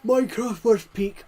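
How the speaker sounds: loud, high goofy voice; silly put-on voice. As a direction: blurt it in a silly character voice. Mock voice repeat.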